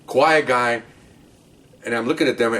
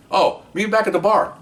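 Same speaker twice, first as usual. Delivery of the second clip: higher, breezy imitated suspect; suspect's casual voice; 10 semitones higher than usual. Suspect telling the cop where to meet, breezy higher. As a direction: toss off the plan lightly.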